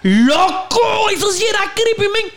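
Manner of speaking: high excited yell; excited shout. Real, not acted. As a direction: shout it out, thrilled and spooked. Excited recall of creepy cartoon.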